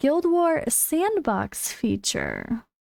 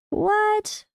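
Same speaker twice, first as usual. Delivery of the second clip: sudden high shocked "what"; surprised; 11 semitones higher than usual. Real, not acted. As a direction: caught off guard, disbelieving. Shocked by patch note.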